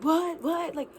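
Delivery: imitating her dad in disbelief at the news; dad freaking out. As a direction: play the stunned dad who can't take it in. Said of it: "he's, like, freaking out".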